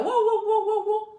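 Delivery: high, mocking repeated wows; mock excited wow. Blogger mocks a commenter's fake excitement, high mock wows. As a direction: parody someone thrilled by a call. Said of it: "si tu es en train de t'agiter".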